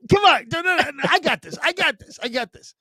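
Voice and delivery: high, pleading mock panic; imitating himself urging the board op. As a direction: beg the producer to dump the call. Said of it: "I'm like".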